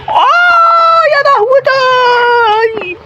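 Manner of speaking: high, drawn-out terrified shriek; panicked scream. Real, not acted. Gamer sees a skeleton in the cave, terrified shriek. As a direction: scream in real fright at the monster.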